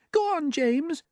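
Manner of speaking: very high, kindly ladybird; gentle. A narrator's reading. Ladybird urging James to explain, gently. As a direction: encourage him gently in a high voice. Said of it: "the ladybird said gently".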